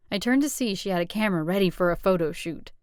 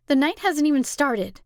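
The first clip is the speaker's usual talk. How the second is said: curt; 8 semitones higher, 6 dB louder than usual. Acted. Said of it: "didn't like the way my voice".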